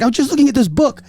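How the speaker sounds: high put-on Chinese accent. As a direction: repeat her excuse in a thick Chinese accent. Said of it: "heavy Chinese accent".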